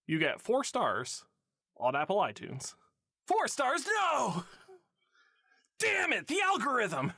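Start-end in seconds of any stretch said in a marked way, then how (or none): 3.2-7.1 high, hammed-up despair; mock outrage. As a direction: wail at the algorithm.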